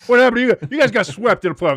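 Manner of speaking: loud, high trash talk; heated comeback. Real, not acted. Host mocks co-host's team for playoff sweep, heated loud comeback. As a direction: fire back at the rival fan. Teasing quarrel about rival teams.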